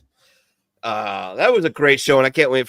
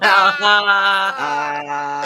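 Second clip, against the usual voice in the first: higher, louder mock yell of a single "Ah"; 10 semitones higher, 6 dB louder than usual. Cousin pretending to yell back at him, hammed-up yell. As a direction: bark out a playful mock yell. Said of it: "Why is the Uber driver yelling at me?".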